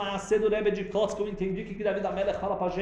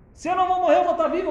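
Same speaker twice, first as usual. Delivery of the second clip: much higher and louder, triumphant; exultant; 13 semitones higher, 8 dB louder, much faster than usual. Shown as a demonstration. Teacher showing the verse's real meaning, exultant. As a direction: burst out with joy at being alive. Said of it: "O que que David Amelech tá falando".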